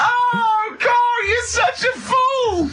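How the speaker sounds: high, slow cry of laughter; howling with laughter at his friend; laughing exasperation. Real, not acted. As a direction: laugh it out in disbelief.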